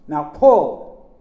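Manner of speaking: shouted command. A narrator's reading. Uncle to nephew in rescue, shouting. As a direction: shout the order to pull. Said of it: "Uncle Chick shouted".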